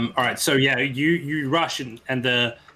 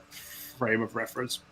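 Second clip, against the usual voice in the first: usual speaking voice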